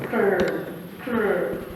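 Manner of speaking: "brr" as a separate low blend sound; consonant blend demo. Shown as a demonstration. Teacher showing how to pronounce blends, low isolated "brr". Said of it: "that's how you're supposed to pronounce".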